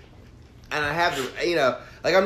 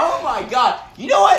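loud retort; 10 semitones higher, 4 dB louder than usual. Real, not acted. Laughing protest at the roast.

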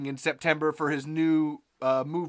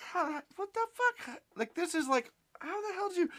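high, softer nerdy dude voice; Steve; 14 semitones higher, 5 dB quieter than usual. Retold or imitated. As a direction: sputter in astonishment as a nerdy guy, pitched up. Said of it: "I'm doing my Steve impression".

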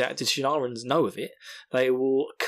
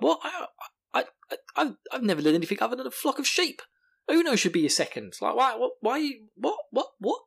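higher, stammering disbelief; mock bewildered protest; 11 semitones higher than usual. Podcaster voicing Rand refusing to be second, mock bewildered. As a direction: sputter in pitched-up confusion. Retelling Rand's baffled protest.

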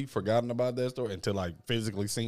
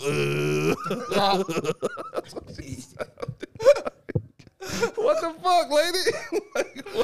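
very high, laughing what the fuck; laughing disbelief; much slower than usual. Real, not acted. Retelling the woman's scream to friends, high laughing disbelief. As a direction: squeal through laughter in disbelief.

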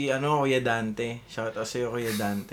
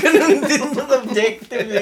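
higher and louder through laughter; laughing; 7 semitones higher, 5 dB louder than usual. Real, not acted. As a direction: say it while laughing. Laughing at game mixup.

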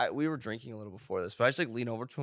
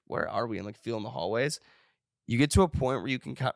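usual speaking voice

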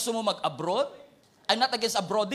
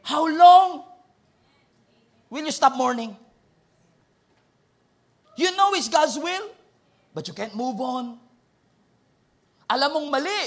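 loud rebuke; 7 semitones higher, 9 dB louder than usual. Acted. Preacher voices God rebuking.

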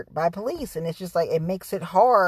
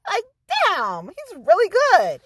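higher-pitched, worked-up outburst; hammed-up indignation, pitched high; mock mad; 9 semitones higher, 4 dB louder, slower than usual. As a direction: retell it as exasperated, grudging praise. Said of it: "so mad about that".

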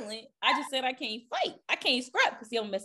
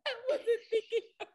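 much higher, giggling through it; laughing protest; 13 semitones higher than usual. Real, not acted. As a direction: defend herself while cracking up. Laughing defence.

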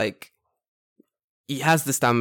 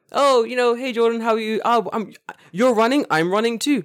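imagined friendly greeter; 10 semitones higher, 5 dB louder than usual. Retold or imitated.

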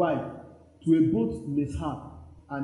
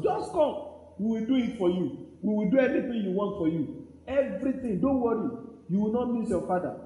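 high-pitched lavish mock assurance; imitated eager promisers; 5 semitones higher than usual. Father's friends promising the son everything, imitated high assurance. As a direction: pile up promises in a raised voice.

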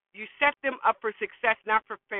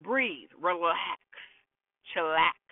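calm, slow; 4 semitones lower, slower than usual. Real, not acted. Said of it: "I hope this inspire you".

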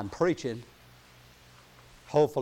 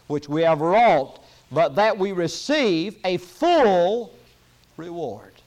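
slow emphatic preaching; 5 semitones higher, 7 dB louder, slower than usual. Acted. Preacher drawing out words.

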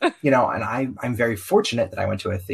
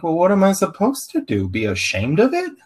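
incredulous retort; 9 semitones higher, 5 dB louder than usual. Retold or imitated. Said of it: "And I'm like".

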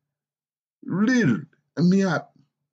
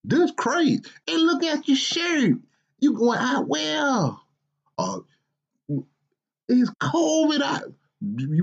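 a grumpy father's higher, exasperated voice; scolding dad; 8 semitones higher, slower than usual. Acted. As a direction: scold your lazy grown son, incredulous. Father rants about son's clothes.

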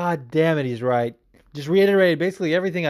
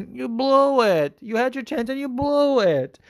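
much higher, slow De Niro-style scolding; Robert De Niro impression; 10 semitones higher than usual. Scolding the fighter for wasting his shot, De Niro voice. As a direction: lean into a tough-guy movie scold. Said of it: "as Robert De Niro would say".

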